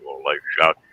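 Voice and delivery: normal talking voice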